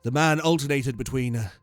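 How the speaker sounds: usual speaking voice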